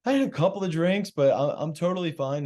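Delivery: high, fast, defensive fib; unconvincing denial. Retold or imitated. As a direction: rush the lie that you're fine. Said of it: "And I'm like".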